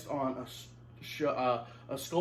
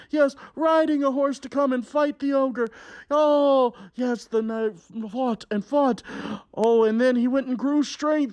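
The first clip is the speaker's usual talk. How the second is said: big, slow fairy-tale narration; hammed-up storyteller for a young audience; storytelling to children; 8 semitones higher, 9 dB louder than usual. A narrator's reading. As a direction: tell it like a melodramatic storybook. Reads fairy tale to kids.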